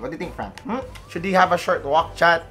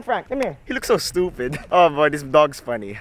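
ordinary delivery

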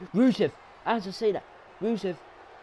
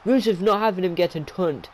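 loud, urgent match commentary; worked-up live wrestling commentary; excited play-by-play; 7 semitones higher, 9 dB louder than usual. Real, not acted. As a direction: call the upset as it unfolds, loudly.

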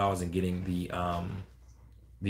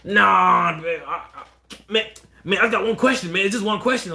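higher, louder begging voice; pleading caller; 8 semitones higher, 6 dB louder than usual. Retold or imitated. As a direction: beg for just one question. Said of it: "mock caller".

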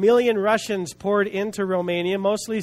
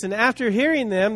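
ordinary delivery

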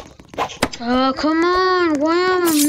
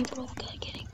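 soft unvoiced whisper; 3 semitones lower, 20 dB quieter than usual. Real, not acted. Streamer sneaking with his friend, hushed whisper. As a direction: breathe the words, barely voiced. Whispering to viewers mid-game.

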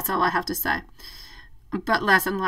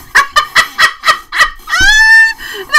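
high burst of real laughter; big laugh; 11 dB louder than usual. Real, not acted. Reader cracking up at a viewer's photo, bursting into laughter. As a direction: laugh out loud at the joke.

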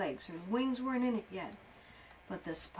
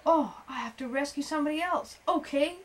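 higher, louder, ready for action; eager resolve; 6 semitones higher, 8 dB louder than usual. A narrator's reading. Beetle off to rescue somebody else, eager resolve. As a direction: jump to the rescue.

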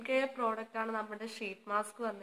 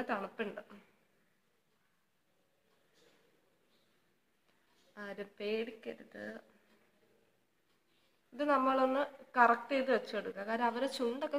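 mask stiffening the lips; through a sheet mask. Real, not acted. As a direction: hands pressing near mouth. Seen 0: Wet sheet mask covers face; she presses it around her mouth.

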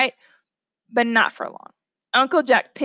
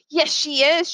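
put-on higher, insistent child's shout; mild shout; 5 semitones higher than usual. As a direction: shout back insistently without full volume. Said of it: "shouted Francis".

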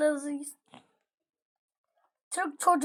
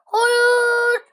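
scream; 7 semitones higher, 10 dB louder than usual. Real, not acted. Panic in game.

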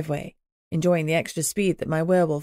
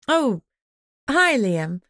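high, louder flustered hi; caught out; 8 semitones higher, 3 dB louder than usual. A narrator's reading. Narrator caught sneaking out by Liam. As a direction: bluff innocently, caught red-handed.

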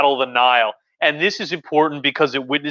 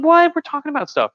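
high surprise; 13 semitones higher than usual. Real, not acted. Reacting to the interruption.

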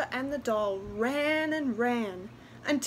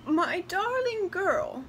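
higher, slow, doting call; grandmother calling out; 7 semitones higher, 4 dB louder, slower than usual. A narrator's reading. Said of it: "a voice called out".

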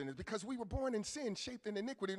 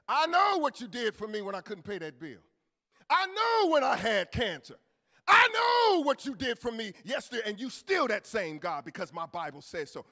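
loud confident praying; faith-filled prayer; 10 dB louder than usual. Acted. Believer reminding God what he did, loud. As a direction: proclaim it back to God. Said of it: "You go with some faith".

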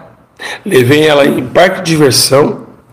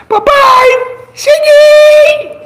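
put-on a little boy bursting in to greet his dad; little son's voice; slower than usual. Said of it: "com toda a vozinha mansa". (voz fininha de criança feliz)

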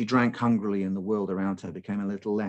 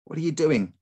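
loud, higher, demanding question; 7 semitones higher, 3 dB louder than usual. Acted. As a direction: demand an answer sharply. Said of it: "she demanded".

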